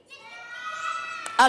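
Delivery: excited shout of yes; cheer. Real, not acted. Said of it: "Are you ready for three?".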